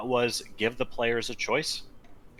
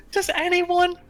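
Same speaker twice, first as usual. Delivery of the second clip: exasperated; 12 semitones higher, 4 dB louder than usual. Retold or imitated. Ranting at article headline.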